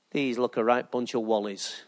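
low, soft inner voice of a doubter; mock inner thought. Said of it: "you're thinking".

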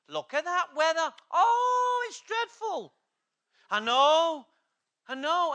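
high, loud mock moaning about the weather; moaning complainer. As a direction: ham up a whingeing Mancunian on the bus. Mocking weather complainers on bus.

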